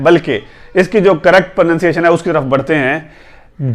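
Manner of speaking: normal talking voice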